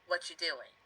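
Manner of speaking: quick, words run together; lazy everyday contraction; contracted fast form. Shown as a demonstration. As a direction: squash it into one quick phrase. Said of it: "It's much easier to say".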